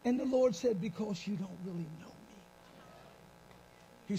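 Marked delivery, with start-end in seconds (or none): 0.8-2.3 gentle God voice. Acted.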